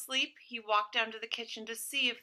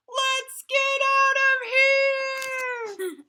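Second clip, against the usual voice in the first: high, slow, drawn-out sung wail; panicked wail; 14 semitones higher, 7 dB louder, much slower than usual. Acted. Burglars choking on gas, fleeing in high wailing panic. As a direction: howl it out as you flee.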